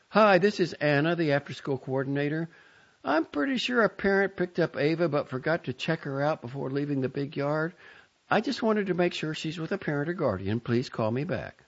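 high, polite coordinator voice; bright professional voicemail. A narrator's reading.

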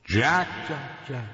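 a hint of an eerie ghost calling; lightly hissed spectral call; ghostly hiss. A narrator's reading. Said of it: "the voice hissed".

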